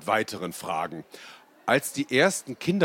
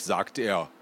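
plain reading voice

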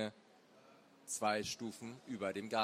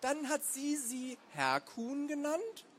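put-on slow, high-pitched boy interrogating; suspicious boy; 14 semitones higher, slower than usual. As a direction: draw the question out in a high kid's voice. Boy presses his suspicion.

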